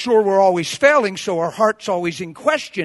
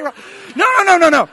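raised, rapid-fire string of no's; emphatic interruption; 9 semitones higher, 9 dB louder than usual. Acted. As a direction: cut the prayer off firmly. Stops the negative prayer.

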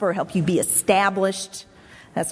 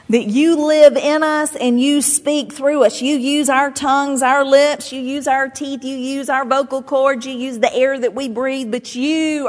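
raised, higher praying voice; fervent prayer; 5 semitones higher, 4 dB louder than usual. Real, not acted. Preacher thanking Father for the Holy Spirit, fervent prayer. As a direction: pray it out in a driving, sustained list. Leads congregation in closing prayer.

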